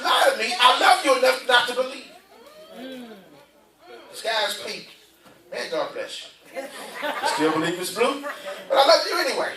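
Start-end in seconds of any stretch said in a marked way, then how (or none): 2.8-4.9 slow, much softer, drawn-out lie; soft mock liar. Preacher voicing a liar to his congregation, slow and soft. Preacher voices a liar's claim. 5.5-9.5 gentle patience. Retold or imitated. Preacher answers the liar gently.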